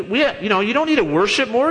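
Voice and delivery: normal talking voice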